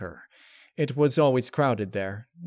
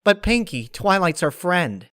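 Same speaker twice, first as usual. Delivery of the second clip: high, louder pony voice objecting; protesting Rainbow Dash; 8 semitones higher, 7 dB louder than usual. Acted. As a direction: push back on your friend.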